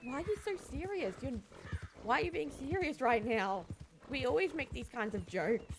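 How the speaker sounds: high, emphatic retold protest; acted exasperated challenge. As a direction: argue back in mock bewilderment. Isabella challenges Jin in retold quarrel.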